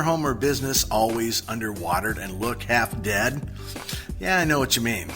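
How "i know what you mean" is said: ordinary delivery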